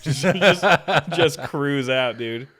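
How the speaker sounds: loud, slow amused build; excited. Real, not acted. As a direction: belt it out laughing. Imagining rider cruising out.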